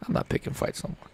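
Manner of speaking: fast, breathless whisper; fed-up fan repeats his vow to himself, whispered. Real, not acted. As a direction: mutter it under your breath, quickly.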